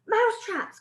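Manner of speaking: high, loud excited echo; thrilled witch chorus; excited high cry; witches crying out. Acted. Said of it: "cried the witches".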